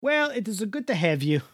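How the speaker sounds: put-on thick Jersey Italian accent; Italian-American accent. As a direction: play a warm old Italian uncle. Held Italian-American character voice.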